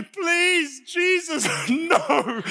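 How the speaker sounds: high, comic begging wail; desperate pleading. Retold or imitated. Sick rider praying against another round, desperate plea.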